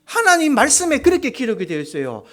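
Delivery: ordinary talk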